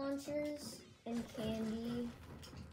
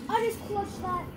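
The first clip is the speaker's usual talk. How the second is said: excited; 8 semitones higher, 8 dB louder than usual. Real, not acted. Repeats the boast, calmer.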